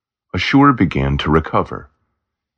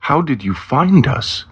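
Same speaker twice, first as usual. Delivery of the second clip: mildly surprised question, pitched up high; higher-pitched, lightly acted surprise; 10 semitones higher than usual. A narrator's reading. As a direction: ask with a touch of astonishment. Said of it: "Roast was surprised".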